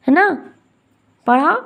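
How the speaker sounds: ordinary talk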